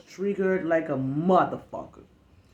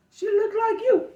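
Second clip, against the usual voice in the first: mock coworker; 4 dB louder than usual. Coworker voiced.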